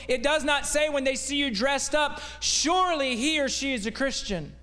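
building preaching. Acted. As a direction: build the point with each repeat.

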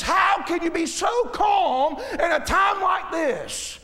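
high, drawn-out mock exasperation; hammed-up indignant disbelief; imitating angry wife. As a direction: wail it slowly like an exasperated wife. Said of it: "she'll get angry with me".

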